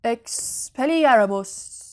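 slow, drawn-out incantation with a loud burst; stretched duelling shout; shouted spell. A narrator's reading. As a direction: cast the spell with a forceful cry. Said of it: "اسنیپ فریاد زد".

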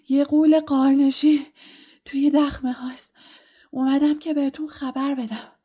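a terrified teacher, out of breath; breathless panic. Acted. Terrified teacher gasping news to Dumbledore, breathless. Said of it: "با نفسهای بریده گفت".

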